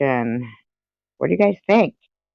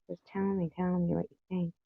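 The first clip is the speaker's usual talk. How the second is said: high, much softer plea; soft coaxing; 7 semitones higher, 11 dB quieter than usual. Real, not acted. Coaxing quiet chat to reply, soft and high. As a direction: gently beg for an answer.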